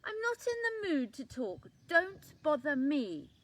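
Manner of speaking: loud, high, rude snap; rude and snappy. A narrator's reading. Rude leaf refusing to talk, loud and snappy. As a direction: snap at them to go away. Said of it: "the leaf was very rude".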